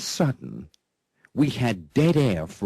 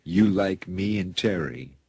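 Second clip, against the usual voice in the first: talking to a pet; 4 semitones lower than usual. Real, not acted. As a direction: coo at the dog like a proud owner. Host talks to his dog guest.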